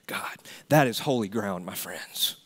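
ordinary talk

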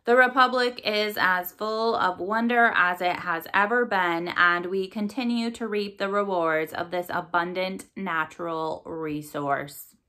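usual speaking voice

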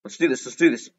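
ordinary talk, nothing marked

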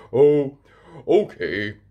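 father agreeing to the rocket, grudging; reluctant Daddy Pig. A narrator's reading. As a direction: give in with a snort. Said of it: "Daddy Pig snorts".

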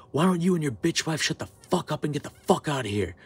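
retold confrontation, punching the swear words; angry retort. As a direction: tell him off forcefully. Retold telling-off of rude fan.

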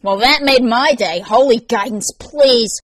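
loud, high derision; gleeful mocking dismissal; scornful amusement. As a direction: scoff at the very idea. Mocks her excuse after laughing.

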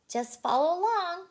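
high, loud call to the group. A narrator's reading. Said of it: "Blue called out".

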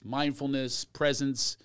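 normal talking voice

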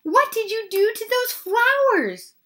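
high, loud, dismayed demand; crying out. A narrator's reading. Missus Rogers confronts her maid about the flowers, crying out. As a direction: demand an answer, pitched right up. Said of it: "she cried".

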